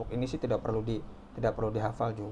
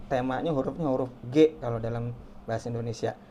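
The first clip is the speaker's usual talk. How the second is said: ordinary talk, nothing marked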